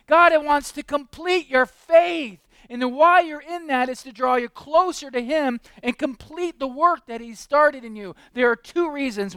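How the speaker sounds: loud, high pulpit intensity; fervent sermon climax; impassioned preaching. Acted. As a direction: drive the point home at full voice. Preaching at full force.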